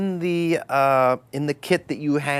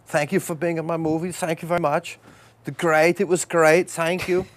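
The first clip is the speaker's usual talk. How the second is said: high, effusive director thanking the crew; raised-pitch, accented mock gratitude; Werner Herzog; 7 semitones higher, 5 dB louder than usual. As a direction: imitate a director handing out repeated thanks. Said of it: "shook every guy's hand".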